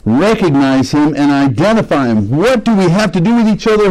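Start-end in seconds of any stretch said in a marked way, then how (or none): 2.3-3.9 higher, lightly acted demon; hint of a startled demon; a touch of demon voice. Shown as a demonstration. Demon recognizing Jesus, lightly acted and higher. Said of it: "he says".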